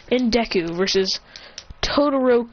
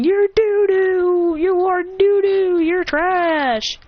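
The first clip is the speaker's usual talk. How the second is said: loud, slow, high-pitched sing-out jeer; trolling taunt; 8 semitones higher, 4 dB louder, much slower than usual. Acted. Hero trolling Bakugo, loud high-pitched jeering. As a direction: stretch out childish insults to needle him. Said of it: "he's just trolling Bakugo now".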